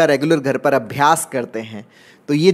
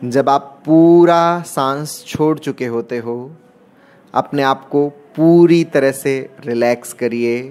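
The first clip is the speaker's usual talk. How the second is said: guided meditation. Acted. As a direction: draw out key words to pace each breath. Leads guided meditation breathing round. (धीमे, शब्दों को खींचते हुए ध्यान का निर्देश)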